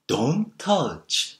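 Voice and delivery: slow, drawn-out gentle coo; forbidding words in a sweet, lilting tone; cooing intonation. Shown as a demonstration. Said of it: "이 억양을".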